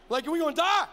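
mock panic, we're doomed; panicked cry. Panicked disciple, loud.

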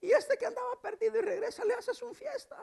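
very high, whiny mock complaint; hammed-up high-pitched grievance; sulking older son. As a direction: complain to papi like a jealous child. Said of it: "El otro hijo se enojó y dijo".

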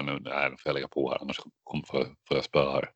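soft, low worried mutter; nervous hushed aside. Retold or imitated. Imitating himself afraid of a fellow passenger, hushed. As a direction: imitate sneaking off the phone, low and quiet. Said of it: "då blir jag också så här".

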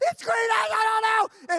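loud high terrified yell; panicked scream. Retold or imitated. Waking up in the tent, panicked scream. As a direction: scream like the monster's here. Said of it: "And I'm like".

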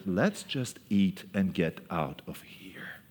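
slow whispered grumble; whispered aside. Acted. Disciples muttering to each other, slow whispered grumble. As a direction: breathe it out slow like a secret. Disciples' impatient wish voiced.

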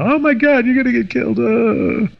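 mock-frantic, shrill-free, high panicked wail; mom flipping out. Mother hearing about the shooting on the phone, imitated high panicked cry. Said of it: "she really flipped out".

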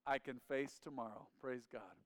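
ordinary delivery

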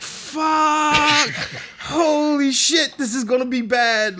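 high, loud panic with a laugh; exposed and terrified, laughing at it; panicked swearing. Retold or imitated. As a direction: caught naked in the closet, high panicked yelp. Closet flung open, exposed.

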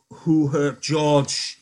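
loud, very slow menacing demand. Acted. Lenny demanding of Crooks who hurt George, menacing. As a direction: loom over him and demand an answer. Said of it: "he demanded".

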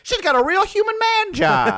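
put-on high old-timey announcer. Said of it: "anytime that voice starts".

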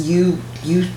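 ordinary talk

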